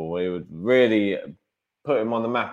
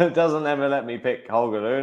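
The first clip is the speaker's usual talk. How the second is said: laughing; 6 semitones higher, 4 dB louder than usual. Real, not acted. Laughs while complaining.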